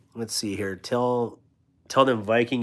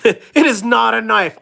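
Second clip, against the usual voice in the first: laughing; 11 semitones higher, 8 dB louder than usual. Real, not acted. Laughing insistence, loud.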